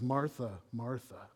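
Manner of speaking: soft, low Martha, Martha; a tender sighing rebuke; gentle chiding. Retold or imitated. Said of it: "Or when he says".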